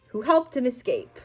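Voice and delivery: ordinary delivery, nothing marked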